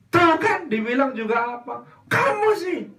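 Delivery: high, loud told-you-so; mother blaming her child, high and loud. Acted. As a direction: pin the blame on the child.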